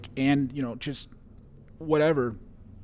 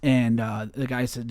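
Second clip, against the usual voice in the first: ordinary talk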